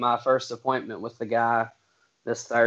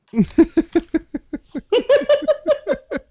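high laugh; 6 dB louder than usual. Real, not acted. Reacting to joke.

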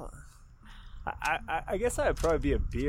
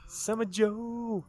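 high and very slow; drawn-out high echo; 10 semitones higher, much slower than usual. Pretend. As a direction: stretch it out up high. Echoes the name, drawn out.

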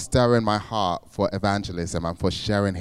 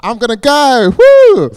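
much higher and louder, ending in a whooping cheer; fired-up whoop; 16 dB louder, slower than usual. Retold or imitated. Steeling herself to approach the lads, whooping excitedly. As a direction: psych yourself up and cheer. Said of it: "but I got so fired up".

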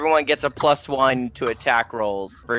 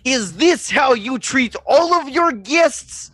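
high, slow, indignant Karen voice; Major Karen Tom; 11 semitones higher, slower than usual. Acted. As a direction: draw out the outraged complaint in a high put-on voice. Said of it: "Major Karen Tom to go stomping".

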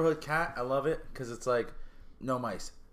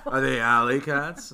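mock cartoon line; 9 dB louder, slower than usual. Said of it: "I didn't mean for that to be serious".

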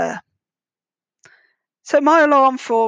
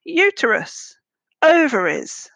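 mock shout, pitched high then falling; imitated shout; 5 semitones higher, slower than usual. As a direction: call it out like a man heard shouting outside. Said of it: "a man's voice just outside in the churchyard shouting".

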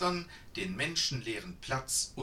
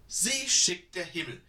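louder, higher glad outcry; delighted exclamation; 5 semitones higher, 7 dB louder than usual. A narrator's reading. Judith thanks the old woman, loud and delighted. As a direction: burst out with relief. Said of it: "rief Judith begeistert".

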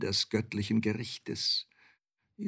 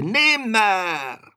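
very high, loud raven screech; piercing raven's cry; croaking raven; 10 semitones higher, 8 dB louder than usual. A narrator's reading. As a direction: croak it higher and louder, long and falling. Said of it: "krächzte da der Rabe".

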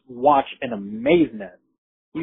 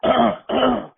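pointed throat-clearing as a signal; demonstrated "ahem" noise; warning throat-clear; 8 semitones higher than usual. Shown as a demonstration. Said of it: "You give that".

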